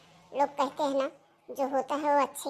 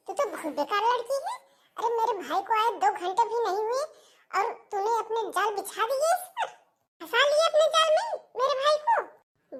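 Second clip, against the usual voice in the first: furious accusation; 7 semitones higher than usual. Acted. Sister-in-law accusing Seema of trapping her brother, furious. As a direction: accuse her, climbing to a shrill peak.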